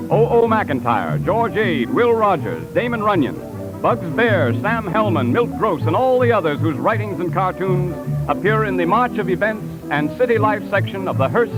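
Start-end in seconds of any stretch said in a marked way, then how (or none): none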